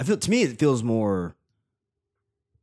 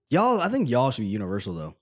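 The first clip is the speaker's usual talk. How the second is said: ordinary delivery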